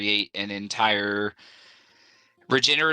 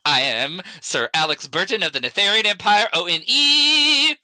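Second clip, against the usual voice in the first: grand proclamation; 9 semitones higher, 5 dB louder than usual. Acted. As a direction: announce the title with a flourish. Announcing his new title.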